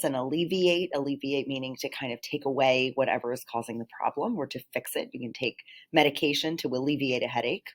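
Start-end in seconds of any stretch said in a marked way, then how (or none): none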